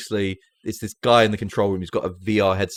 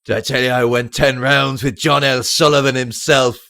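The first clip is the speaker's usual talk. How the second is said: put-on old boxer's boast; 3 semitones higher, 7 dB louder than usual. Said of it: "And he goes".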